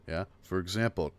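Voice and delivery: ordinary talk, nothing marked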